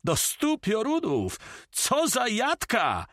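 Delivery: very high, drawn-out exclamation; shouting. A narrator's reading. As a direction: crow it high, stretching the words. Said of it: "krzyczał Carmen".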